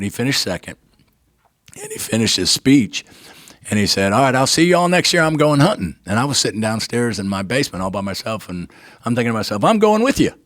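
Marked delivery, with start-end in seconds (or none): 4.1-6.0 higher, louder and fast, a happy farewell; cheerful sign-off. Retold or imitated. Quoting friend's banquet speech sign-off. 9.6-10.3 much higher and louder, bursting with resolve; thinking to himself about joining the hunt, eager. Retold or imitated. Thinking to himself, deciding to join.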